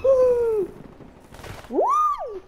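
shrill-rising panicked yelps; panicked squeals. Real, not acted. Fight with enemy.

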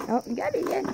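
ordinary talk, nothing marked

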